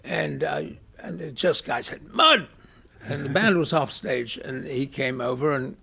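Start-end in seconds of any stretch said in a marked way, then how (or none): 2.1-2.5 calling out. Retold or imitated. Bartender calls out to Muddy.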